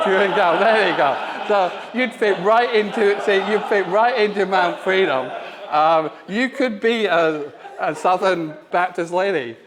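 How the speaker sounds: ordinary delivery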